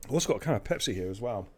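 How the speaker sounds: ordinary talk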